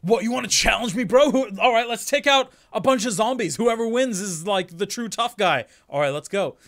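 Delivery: loud, high, macho dare; hammed-up bro bravado; tough-guy challenger. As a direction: goad them into a contest, full volume. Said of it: "think of him being like".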